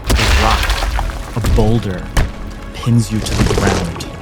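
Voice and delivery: ordinary delivery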